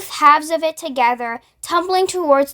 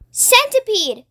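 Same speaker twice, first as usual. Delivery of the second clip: high urgent yell of a name; 7 semitones higher than usual. Acted. James yelling at the centipede to act fast. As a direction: call him sharply across the peach. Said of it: "yelled James".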